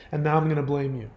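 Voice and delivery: usual speaking voice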